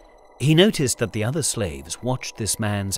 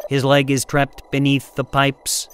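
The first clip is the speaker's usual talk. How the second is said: robot voice; 3 semitones higher, 4 dB louder than usual. A narrator's reading. Robot Zeta reports.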